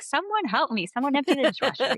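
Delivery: mock snap at the household, half-joking; frustrated plea. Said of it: "and I'm like".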